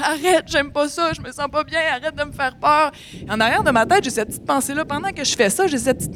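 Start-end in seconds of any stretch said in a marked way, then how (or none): 0.0-2.9 high-pitched frightened begging; imploring. Retold or imitated. To the man in the field, high imploring plea. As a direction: beg him to stop, voice pushed up. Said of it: "je me mets à l'implorer".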